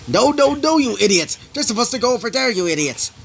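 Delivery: high, loud angry boss shouting; angry yelling. Acted. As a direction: chew out clumsy henchmen at full volume. Crime boss yelling at his henchmen.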